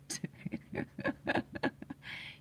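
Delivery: real breathy chuckle; amused laugh. Real, not acted. Storyteller recalling his startled friend, amused laugh. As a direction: chuckle helplessly at your own story.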